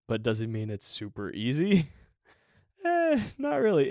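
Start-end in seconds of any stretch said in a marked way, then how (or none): 2.8-3.9 mock doubtful. Real, not acted. Answering own question with a twist.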